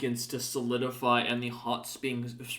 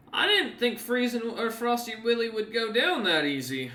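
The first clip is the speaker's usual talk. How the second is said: higher, impressed NPC remark; Scartsmander's voice; 9 semitones higher than usual. Acted. Scartsmander impressed that the party beat Frosty Willy. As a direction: sound mildly impressed. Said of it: "kinda gives a light whistle and goes".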